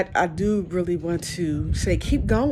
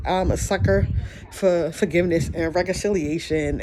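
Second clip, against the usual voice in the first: ordinary delivery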